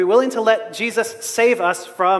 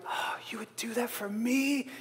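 mock whisper; 15 dB quieter than usual. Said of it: "everyone else around me is like".

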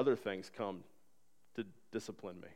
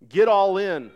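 loud, high rallying cry; preaching shout; 7 semitones higher, 13 dB louder, slower than usual. Real, not acted. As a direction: punch it out at full preaching force. Preacher's climactic exhortation.

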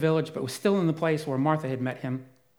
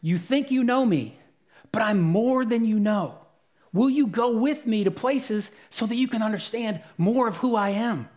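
raised, urgent voice of Jesus pleading; a touch louder, pressing invitation; Jesus speaking; 5 semitones higher, 4 dB louder than usual. Retold or imitated. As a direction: preach his words as an earnest call. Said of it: "he is saying to them".

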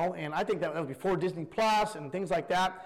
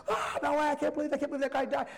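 disbelief; 7 semitones higher, faster than usual. Retold or imitated. Typical viewer reaction to show.

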